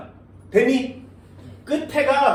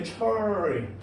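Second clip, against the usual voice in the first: 철 drawn out slowly as if English; playful English-style reading of 철; mock English accent; 5 dB quieter, much slower than usual. Shown as a demonstration. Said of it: "영어야?".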